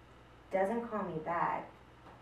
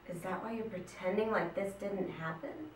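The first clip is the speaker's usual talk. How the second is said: usual speaking voice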